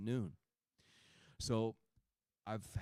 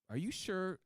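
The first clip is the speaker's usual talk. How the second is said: much higher, disbelieving question; doubtful; 11 semitones higher than usual. Retold or imitated. Unconvinced patient questioning the doctor's simple advice, doubtful. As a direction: imitate a doubter, pitch leaping up.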